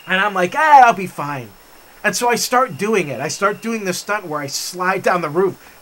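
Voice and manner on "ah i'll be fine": loud, dismissive retold brush-off. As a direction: wave the danger away loudly. Brushing off friends' fears on roof.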